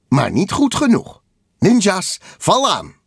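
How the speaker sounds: high, loud, commanding taunt; villain's attack command. A narrator's reading. Rival Kabuto setting ninjas on Takel, loud and commanding. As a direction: raise the voice into a battle order.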